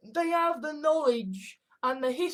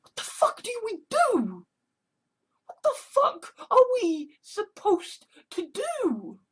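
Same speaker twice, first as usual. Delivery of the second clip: high, slow mock wail; high whimpering cry; mock despair; 10 semitones higher than usual. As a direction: wail it like it's all hopeless.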